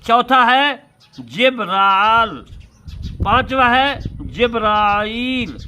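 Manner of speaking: loud, slow, drawn-out listing of names; sermon enumeration, each name drawn out; slow preaching list. Acted. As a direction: proclaim each form of the name slowly. Preacher listing the six forms.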